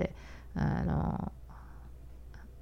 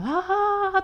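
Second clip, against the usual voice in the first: high squealed aah of surprise; delighted gasp. Retold or imitated. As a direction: gasp like a thrilled auntie. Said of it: "とか言って".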